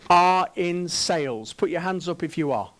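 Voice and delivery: ordinary talk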